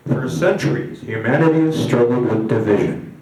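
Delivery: solemn promo film voiceover; grand, measured screen narrator; propaganda narrator. Acted. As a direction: intone it like a propaganda reel. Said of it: "and the narrator comes in".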